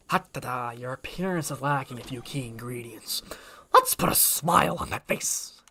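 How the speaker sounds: a villain impression, slowing on the final line; Heath Ledger's Joker. Streamer demonstrating a learned impression, menacing Joker voice. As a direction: imitate the movie villain, drawing out the threat. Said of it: "Same thing with Heath Ledger's Joker".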